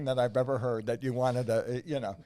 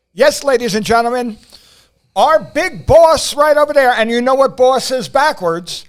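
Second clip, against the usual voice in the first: big announcer build-up; 9 semitones higher, 16 dB louder than usual. Acted. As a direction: roll out the name like a ring announcer. Announcer introduces John Kensel.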